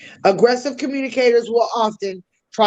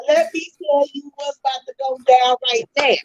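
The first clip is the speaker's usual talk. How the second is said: pitched up, far-off voice, squaring up for a fight; aggressive confrontation; 4 semitones higher than usual. Retold or imitated. A person calling out someone who did them wrong, aggressive. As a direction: threaten like someone about to start trouble.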